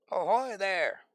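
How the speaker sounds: high, drawn-out nautical hail; mock boat hail. Naval call out to the unknown boat, mock hailing. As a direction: hail a passing ship, playfully.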